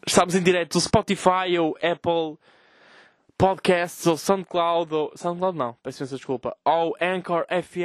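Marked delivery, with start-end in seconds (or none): 0.1-4.1 radio announcer. Pretend. Radio host intro.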